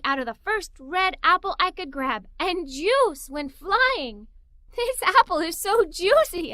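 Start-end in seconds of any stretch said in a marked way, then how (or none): 4.7-6.5 higher and louder, giggly delight; giggling. Acted. Girl tasting a juicy apple, higher, louder and giggling. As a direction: gush through a giggle over the apple. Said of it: "I said, giggling".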